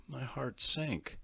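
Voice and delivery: plain reading voice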